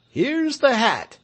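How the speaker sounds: high, slow triumphant shout; excited shout. Acted. Villager Ruodi shouting to the crowd on finding the hat. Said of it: "shouted Ruodi".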